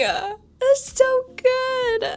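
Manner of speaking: high, very slow, stretched out in delight. Real, not acted. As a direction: savour the joke. Delighted after laughing.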